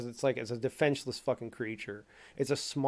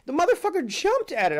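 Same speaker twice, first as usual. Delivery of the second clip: much higher, louder, still amazed; excited; 6 dB louder than usual. Real, not acted. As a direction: blurt it out in disbelief. Shocked retelling of turtle attack.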